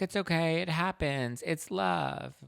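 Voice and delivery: high, sing-song making-up voice; lightly mocking lovey-dovey tone; mock sweet reassurance. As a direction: brush it all off like a lovesick couple. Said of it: "and they're like".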